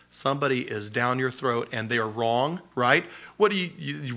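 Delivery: usual speaking voice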